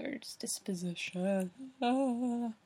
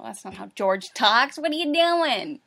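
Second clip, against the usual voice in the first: exasperated self-scolding; 9 dB louder than usual. Real, not acted. Reader scolds self over George's voice, exasperated. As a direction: scold yourself loudly, voice shooting up. Exasperated at own misreading of the character.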